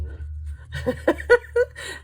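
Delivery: high breathy burst of laughter; high giggle of embarrassment; embarrassed laugh. Real, not acted. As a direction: laugh at being caught. Caught by son on camera.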